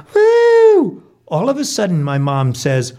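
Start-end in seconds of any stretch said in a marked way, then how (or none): 0.1-1.0 loud, very high "woo" from the stands; cheering whoop. Acted. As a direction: let out a piercing whoop for the driver. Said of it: "yells the family".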